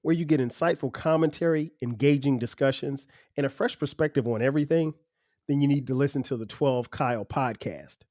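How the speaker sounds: host reading his own podcast promo, softly. Acted. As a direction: sell the show like a radio ad.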